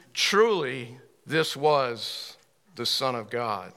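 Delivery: measured, declaimed scripture; scripture reading. Acted. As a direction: read the verse out deliberately, settling on the last phrase. Reads scripture aloud to congregation.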